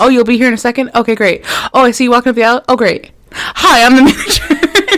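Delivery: high, loud pretend phone chat; fake phone conversation. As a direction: exaggerate a manager's call for an eavesdropper. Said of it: "put the little extra emphasis".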